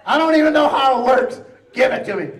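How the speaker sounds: high, loud, desperate; desperate demand. Retold or imitated. Patient demanding morphine, loud high desperate. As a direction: beg for it at full volume.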